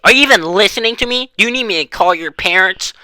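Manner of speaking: high-pitched imitation of her scolding; yelling. Vice principal threatening to call a student's parents, yelling. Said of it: "She was yelling at me".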